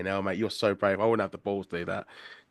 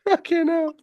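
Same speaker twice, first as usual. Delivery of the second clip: high, loud laughter through the words; laughing in disbelief; 7 dB louder than usual. Real, not acted. Host hearing about the roast kiss, laughing incredulously. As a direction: crack up while talking, pitch shooting up. Listener cracking up at the story.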